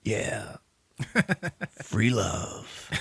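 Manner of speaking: slow, spaced-out hippie murmur; stoned ex-hippie. Acted. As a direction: savor it after a big pull of gas. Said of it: "He's an ex-hippie and shit".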